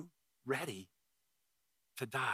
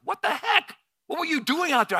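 much higher, loud incredulous friend; Rick's reaction; 10 dB louder than usual. Retold or imitated. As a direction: call it out in disbelief from the beach. Retold friend Rick on shore.

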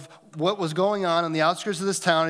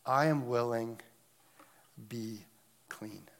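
soft, fading tender words; gentle Jesus; 4 semitones lower, 6 dB quieter than usual. Acted. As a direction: say it tenderly, trailing off.

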